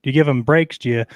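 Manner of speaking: ordinary delivery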